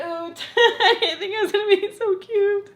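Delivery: high laughing, tearful voice; crying. Real, not acted. Vlogger surprised by cute gift, tearful laughing. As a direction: squeeze it out high between laughs and tears. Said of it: "I don't know why I'm crying".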